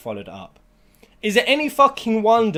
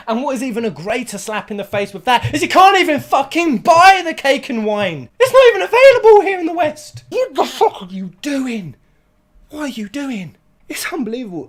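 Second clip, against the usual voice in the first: much higher and louder, slowing on the last words; furious rant; 10 semitones higher, 10 dB louder than usual. Real, not acted. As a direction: build into an outraged yell. Ranting about unavailable cake and wine.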